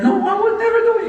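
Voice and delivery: high, fast laughing denial; lightly voiced wife, high and fast; squeaky quick denial. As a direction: blurt it out in a high rush.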